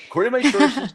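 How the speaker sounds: high insider read; Schefter voice. Retold or imitated. Schefter reading his sources, high. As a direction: read it like a scoop. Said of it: "I'm gonna read it".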